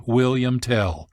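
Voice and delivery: ordinary talk, nothing marked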